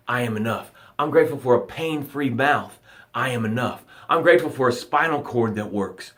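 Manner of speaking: calm them down, breath by breath; guided meditation. Acted. Leads a breathing gratitude meditation.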